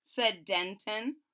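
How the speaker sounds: plain reading voice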